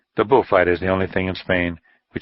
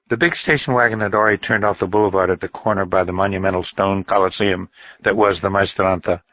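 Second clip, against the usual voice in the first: ordinary delivery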